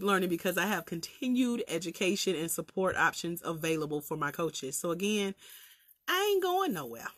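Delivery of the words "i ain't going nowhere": Southern country twang, teasing; country accent. Real, not acted. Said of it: "Me and this country accent".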